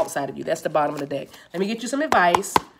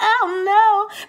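laughing; 6 dB louder than usual. Real, not acted. Laughs after singing.

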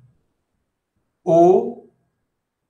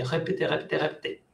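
fading repetition; 3 semitones lower, 11 dB quieter, much faster than usual. Real, not acted. Teacher urges repetition.

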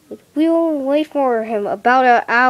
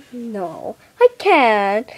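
usual speaking voice

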